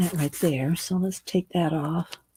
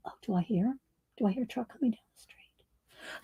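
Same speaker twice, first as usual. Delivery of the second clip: hushed whisper, listening; 11 dB quieter than usual. Real, not acted. Listening for the mail truck.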